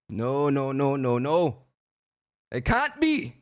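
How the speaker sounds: hammed-up wail, rising high at the end; mock anguished denial. Reacting to his buddy confirming the news, mock anguished denial. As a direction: refuse to believe it, melodramatically.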